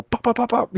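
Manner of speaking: high-pitched popping gunfire noises; imitated gunshots. Telling friend about shots from the car, high mimicked gunfire. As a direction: rattle off high little pops. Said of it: "you see the car go".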